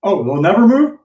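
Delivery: irritated homeowner. Retold or imitated. Homeowner shuts down agent.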